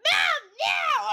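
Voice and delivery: very high, loud imitated yells; mock cat screaming. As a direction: scream like an impatient pet calling its mother. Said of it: "he's just screaming".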